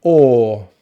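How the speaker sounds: "or" held, tongue back in the mouth; long vowel demo. Shown as a demonstration. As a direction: sustain "or" with rounded lips. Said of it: "tongue comes back into the mouth".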